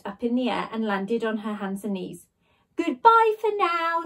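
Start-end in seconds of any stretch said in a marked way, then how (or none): 2.8-4.0 high, slow teasing farewell; sloot teasing. A narrator's reading. The sloot bidding Jemima goodbye, high and slow. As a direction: sign off with a wink. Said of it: "said the sloot".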